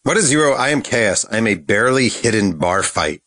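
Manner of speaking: ordinary talk, nothing marked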